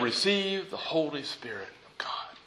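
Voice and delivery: hushed, breath-soft reading of Jesus's words; breathed blessing. Acted. Jesus breathing the Spirit onto his disciples, hushed. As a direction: let it sink almost to a whisper. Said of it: "Then he breathed into them".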